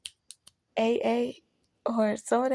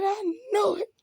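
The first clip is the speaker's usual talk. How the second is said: high, half-crying outburst; high pained realization, rising into a louder complaint; exasperated outburst; 7 semitones higher, 3 dB louder than usual. Retold or imitated. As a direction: wail it out higher, like you finally get it. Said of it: "I said".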